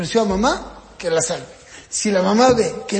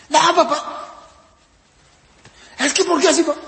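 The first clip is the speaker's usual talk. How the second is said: put-on high, louder child's protest; protesting son; 8 semitones higher, 5 dB louder than usual. Son objects to father's nail rule, high protest. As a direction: plead loudly with dad, pitched up.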